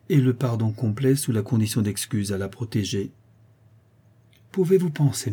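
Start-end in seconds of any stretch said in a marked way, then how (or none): 4.5-5.3 high, indignant questioning; defiant child. Acted. Intractable boy answering his mother, defiant. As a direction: protest high, as if insulted.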